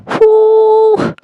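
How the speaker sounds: sudden high whoop of disbelief; a high, exasperated whoo; excited whoop. Real, not acted. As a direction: let out a sharp, high whoop. Reacting to the theft.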